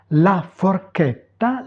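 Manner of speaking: "La forchetta" slowly, syllable by syllable; teacher modelling "La forchetta" slowly in Italian; Italian pronunciation demo. Shown as a demonstration. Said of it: "prononcer les mots". (prononcer « La forchetta » lentement, à l'italienne)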